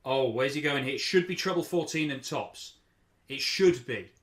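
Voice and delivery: ordinary talk, nothing marked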